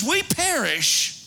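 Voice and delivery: high, drawn-out acted lament; desperate cry. Preacher quoting the drowning disciples, acted desperate cry. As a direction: stretch it out high like a doomed plea. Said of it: "It says".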